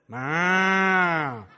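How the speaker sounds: drawn-out imitation cow call; long mock cow noise; cow moo. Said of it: "The cow says".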